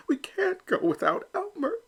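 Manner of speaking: high, pleading mother duck; a mother's anxious high-pitched plea; mama crying out. Acted. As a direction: cry it out high as a worried mama. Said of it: "he heard his mama cry".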